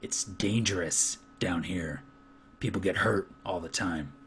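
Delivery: low, soft, scolding foreman voice; stern foreman. Acted. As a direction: scold him quietly from low in the chest.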